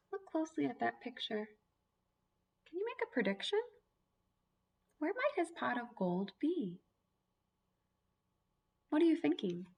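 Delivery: normal talking voice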